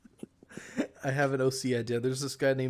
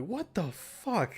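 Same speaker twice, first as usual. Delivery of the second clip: baffled; 4 semitones higher, 3 dB quieter, much slower than usual. Retold or imitated. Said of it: "I'm like".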